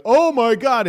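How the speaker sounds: high, loud mock gasp of shock. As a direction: squeal it like a shocked fan. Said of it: "you're like".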